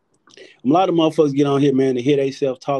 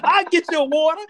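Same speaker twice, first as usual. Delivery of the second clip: mock Smokey; 11 semitones higher than usual. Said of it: "He's like".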